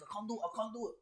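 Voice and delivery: high, soft whimper; defeated whimper. Real, not acted. Player giving up on the game, small high whimper. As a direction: whimper it, trailing away.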